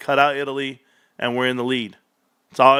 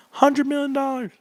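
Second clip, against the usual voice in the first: excited; 11 semitones higher than usual. Real, not acted. Gleeful reading of numbers.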